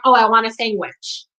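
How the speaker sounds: "sandwich" pronounced "SANG-wich"; sangwich. Shown as a demonstration. Recalling herself asking for food, "sandwich" said as "sangwich". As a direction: say "sandwich" with ng in place of nd. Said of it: "grew up saying sandwich".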